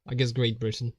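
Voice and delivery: ordinary talk, nothing marked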